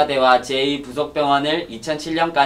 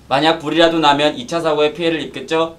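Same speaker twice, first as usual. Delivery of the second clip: ordinary talk, nothing marked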